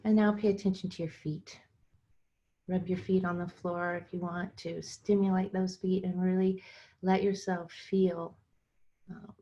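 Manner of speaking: unhurried guided meditation with long pauses. Acted. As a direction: guide listeners into their bodies phrase by phrase, leaving room between.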